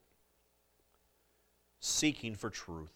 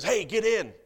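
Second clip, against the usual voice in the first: higher, louder casual call from a car; friendly driver calling; 8 semitones higher, 8 dB louder than usual. Retold or imitated. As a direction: call him in off the road. Justin offers a ride.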